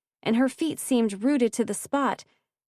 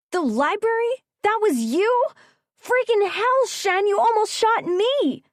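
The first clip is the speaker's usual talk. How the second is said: high, loud disbelieving outburst; shocked outrage; 13 semitones higher, 4 dB louder than usual. A narrator's reading. Ridley shocked she was shot at.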